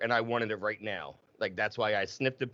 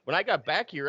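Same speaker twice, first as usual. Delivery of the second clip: normal talking voice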